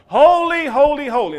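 loud, high exalted cry of holy; worshipful cry. Acted. Bowing worshippers crying holy in awe, loud and high. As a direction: lift your voice in awe. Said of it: "they bow down and they look up and they say".

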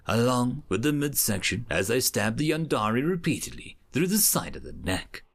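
plain reading voice

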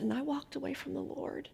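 retold tearful confession, hushed and high; small, high, choked-up quote. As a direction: imitate someone confessing through tears, very softly. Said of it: "she starts crying".

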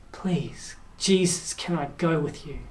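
soft, low plea, gently acted; hushed pleading. Healed man begging Jesus to go along, softly pleading. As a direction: beg quietly, almost under your breath. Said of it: "who begged".

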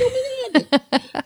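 squealed high oh my God; shocked gasp. Retold or imitated. Retold shock in cooler.

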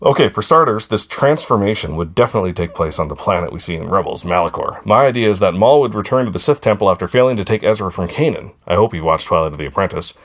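fast, rushed, no breaths; all in one breath. Real, not acted. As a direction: race through it without breathing. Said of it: "I'm gonna try to do it in one breath".

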